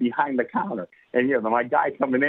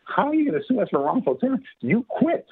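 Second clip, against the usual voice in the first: high, disbelieving boss voice; mock the absurd lawsuit in a high incredulous voice; 10 semitones higher than usual. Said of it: "Bill looks at me and says".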